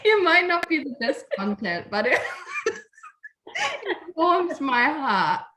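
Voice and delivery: high teary voice breaking into laughter; sniffling happy tears, laughing; tearful. Real, not acted. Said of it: "us sobbing".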